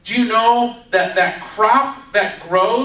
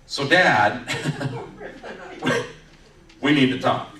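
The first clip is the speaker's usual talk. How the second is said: sheepish bad news; 4 semitones lower than usual. Sons nervously break bad news.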